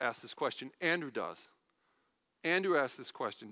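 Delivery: usual speaking voice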